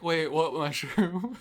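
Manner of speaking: high, drawn-out confused question; bewildered. Retold or imitated. Clueless raider answering the mushroom question, bewildered. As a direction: ask it slowly in mock alarm. Retells own bewildered reply.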